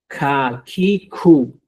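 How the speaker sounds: kakiku stretched very slowly, each syllable apart; very slow drill. Shown as a demonstration. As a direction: drag kakiku out for the class. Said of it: "para que usted practique".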